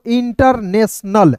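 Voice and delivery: "इंटरनेशनल" slowly, split as in-tar-nes-nal; teacher's slow, chunked "इंटरनेशनल"; syllable-by-syllable reading. Shown as a demonstration. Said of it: "तो क्या पढ़ेंगे दोस्तों".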